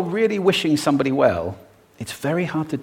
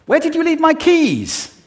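much higher and louder; high-pitched, aroused; 6 dB louder than usual. Shown as a demonstration. Speaker showing how pitch changes a question's meaning, high-pitched. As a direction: send the pitch way up, agitated. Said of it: "do it just with pitch".

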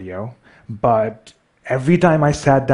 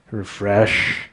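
normal talking voice

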